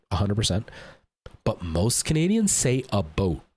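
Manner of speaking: normal talking voice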